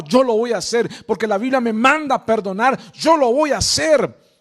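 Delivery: loud, urgent preacher's exhortation; fervent preaching. Acted. Preacher urging the congregation to obey, loud and fervent.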